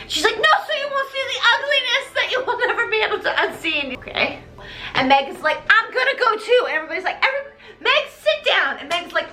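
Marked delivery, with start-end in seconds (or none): 0.4-4.0 high, fast, breathless run-on; mock dire warning. Madame Giry warning Raoul about the ugliness, high urgent rush. 5.7-6.6 high, keen little-volunteer voice; mock eagerness. Meg insisting on joining the search, high eager pipe-up. 7.2-8.8 high, exasperated telling-off; mock scolding. Everybody shutting Meg down, high exasperated scolding.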